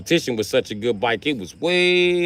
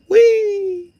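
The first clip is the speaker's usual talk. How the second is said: gleeful whee; 8 dB louder than usual. Retold or imitated. Riding off in joy.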